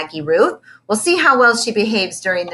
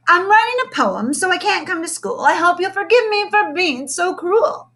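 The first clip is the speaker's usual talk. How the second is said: high, sing-song recited rhyme; bursting into verse; 10 semitones higher than usual. A narrator's reading. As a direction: declaim it like a poem. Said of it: "Lil burst into verse".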